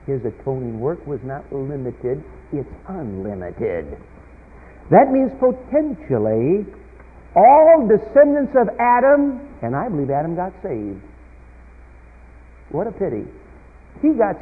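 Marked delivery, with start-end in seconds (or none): 4.9-9.4 loud, ringing pulpit delivery building to peaks; preaching at full force. Acted. Preacher telling his congregation a conversion story, preaching at full force. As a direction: preach it out to the back pews.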